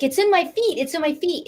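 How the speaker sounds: high, urgent re-enacted exclamation; urgent retelling. Client telling healer where the pain went, urgent. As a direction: exclaim it as if it's happening. Retold urgent cry during treatment.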